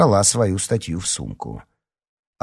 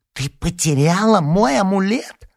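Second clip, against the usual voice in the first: high, loud, drawn-out wail; outraged yell; 13 semitones higher, 5 dB louder than usual. A narrator's reading. Alyona accuses Larisa of losing her amulet, yelling. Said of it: "завопила вдруг Алёна".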